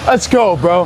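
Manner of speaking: loud, high gym bro cheer; hyped cheer. Real, not acted. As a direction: pump the bro up. Celebrating the lift.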